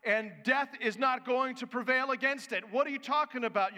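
loud, high preaching voice. Acted. Preacher to his congregation, forceful preaching.

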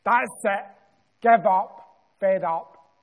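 loud, fed-up moan of giving up; mock sulky loud outburst. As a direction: throw the words out like a sulking lad quitting. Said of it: "I sat down and I sulked and I said".

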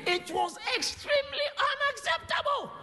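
high preaching shout. Acted. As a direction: cry it out high. Preacher's climax.

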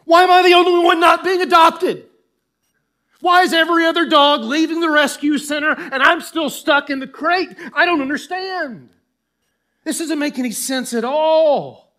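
high, loud outcry building to a shout; preacher acting a man left behind; escalating protest. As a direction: build from argument to indignant yelling. Preacher voices David's escalating protest.

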